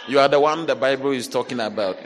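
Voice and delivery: ordinary delivery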